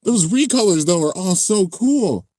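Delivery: high-pitched fan enthusiasm; gushing. Real, not acted. Streamer admiring the recolors, gushing excitement. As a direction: rave with real delight, pitched high.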